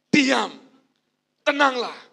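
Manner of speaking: loud, high authoritative command; commanding rebuke. Acted. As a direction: speak it like you own the storm. Jesus rebukes the storm.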